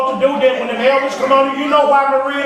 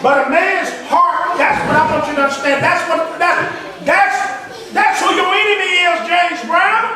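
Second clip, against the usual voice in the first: full-force preaching; 5 semitones higher than usual. Acted. Preacher chanting at the congregation, full force. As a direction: belt it to the congregation.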